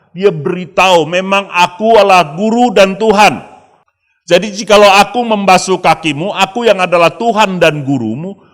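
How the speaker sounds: loud, high, forceful sermon; preaching at full force. Acted. Preacher thundering Jesus' words to the church, loud and high. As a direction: proclaim it like a rally.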